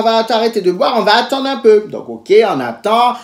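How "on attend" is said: ordinary talk, nothing marked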